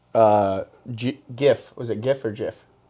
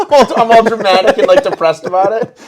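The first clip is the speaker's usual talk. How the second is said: laughing; 9 semitones higher, 8 dB louder than usual. Real, not acted. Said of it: "laughing self-mockery".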